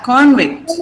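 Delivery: convict as CON-vict; first-syllable stress. Shown as a demonstration. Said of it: "First syllable".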